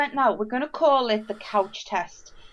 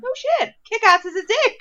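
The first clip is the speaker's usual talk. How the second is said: surprised realisation; 12 semitones higher, 4 dB louder than usual. Retold or imitated. Retold realisation of who it was.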